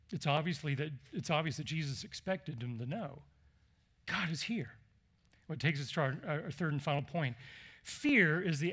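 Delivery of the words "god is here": softly whispered, no voice. Shown as a demonstration. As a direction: breathe it as a secret to the room. Preacher repeats line, now whispered.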